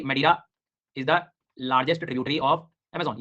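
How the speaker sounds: very slow, word-by-word "largest tributary of Amazon"; slow dictation. Shown as a demonstration. Teacher dictating "largest tributary of Amazon" slowly to note-takers. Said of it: "ये मैंने बोला".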